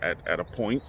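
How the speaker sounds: ordinary delivery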